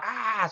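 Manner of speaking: high-pitched mock scream; retold scream. Presenter screaming at people walking past, mock scream. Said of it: "screaming in the face".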